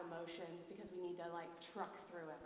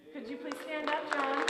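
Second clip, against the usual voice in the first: calling out loud; 7 semitones higher, 12 dB louder than usual. Real, not acted. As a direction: call across the room, loud and high. Calling John to stand up.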